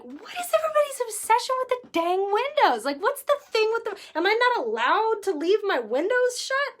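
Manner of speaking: high and exasperated, voice pushed up. Retold or imitated. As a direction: throw up your hands at the window obsession. Exasperated thought about windows.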